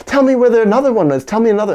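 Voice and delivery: high, fast and loud, pressing her; an eager child's loud, fast demand; loud childlike demand. Retold or imitated. Child demanding another piece.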